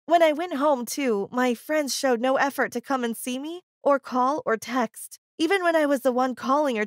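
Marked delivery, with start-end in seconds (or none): none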